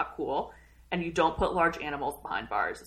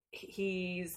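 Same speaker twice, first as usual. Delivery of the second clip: normal talking voice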